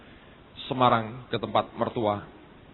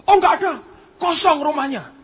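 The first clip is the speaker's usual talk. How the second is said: loud, high dismissive exclamation; high-pitched animated mimicry; imitating excited informant; 12 semitones higher, 7 dB louder than usual. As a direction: blurt it out with mock eagerness. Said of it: "Om ini cerita dengan semangat".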